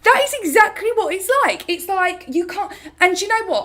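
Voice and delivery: real excitement at a comment; excited agreement. Real, not acted. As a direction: burst out in eager recognition.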